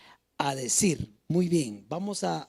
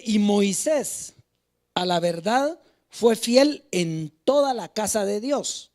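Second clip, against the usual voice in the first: slow, emphatic scripture reading; 4 dB louder, slower than usual. Acted. Preacher reading the verse on Moisés to the church, slow proclamation. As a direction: proclaim the verse slowly, phrase by phrase. Reads scripture aloud to congregation.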